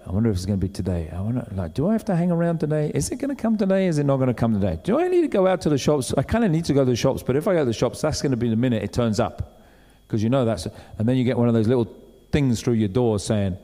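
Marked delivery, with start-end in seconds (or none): 0.1-9.5 rapid-fire, fretful run of questions; impatient fretting. Retold or imitated. Someone waiting at home for a parcel, fast fretting. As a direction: rattle the worries off quickly.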